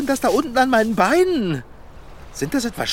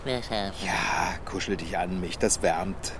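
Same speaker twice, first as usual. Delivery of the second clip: cozy hush; 11 dB quieter, slower than usual. Acted. Cuddling up on the beach.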